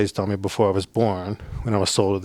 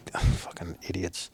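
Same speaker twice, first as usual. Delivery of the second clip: much softer, slow contemptuous mutter; scornful mutter; 3 semitones lower, 9 dB quieter, slower than usual. Retold or imitated. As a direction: dismiss them under your breath. Scornful aside about prayer people.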